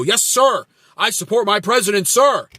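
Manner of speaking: a fawning MAGA supporter saying yes sir; mocking loyal follower. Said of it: "and you go".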